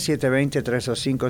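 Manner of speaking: ordinary delivery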